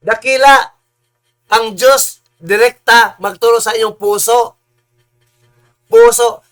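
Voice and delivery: loud, soaring proclamation; fervent preaching. Real, not acted. Preacher proclaiming God teaches hearts directly, fervent and loud. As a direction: proclaim God's greatness with full force.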